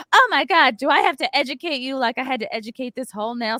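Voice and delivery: high, loud, fast entitled rant; hammed-up entitled customer nagging; mock Karen clucking. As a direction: mimic a clucking, lecturing Karen.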